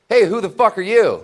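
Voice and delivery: high, loud mock yell of an angry stranger. A girl yelling at a naked stranger, a high, loud mock yell. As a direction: bark it out like a startled roommate. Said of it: "She goes, uh".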